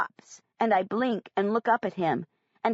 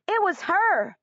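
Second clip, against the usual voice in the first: much higher, eager and bright exclamation; excited little boy; 9 semitones higher than usual. Acted. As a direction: point her out with glee. Michael excitedly points at Scout.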